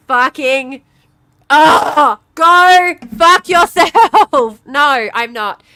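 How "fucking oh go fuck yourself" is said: much higher, much louder, slow drawn-out yell; raging scream. Real, not acted. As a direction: let it all explode, stretching every word. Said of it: "I'm too angry".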